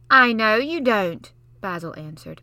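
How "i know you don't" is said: put-on much louder, slow smug boy; smug retort. Basil sneering at Mary, smug retort. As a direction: rub it in.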